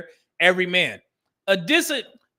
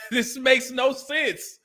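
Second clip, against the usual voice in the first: laughing in disbelief; 5 semitones higher, much slower than usual. Real, not acted. Reacting to a clip he finds absurd.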